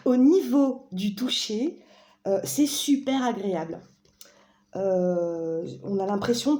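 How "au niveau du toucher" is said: "Au niveau du toucher" slowly and deliberately, higher; careful retake. Real, not acted. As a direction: draw out each word of "Au niveau du toucher" after a slip. Careful retake after the slip.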